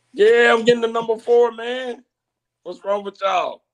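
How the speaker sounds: high-pitched, defiant insistence; defiant protest. Real, not acted. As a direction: start loud and high, protesting to the doubters. Defiant answer to doubting fan's comment.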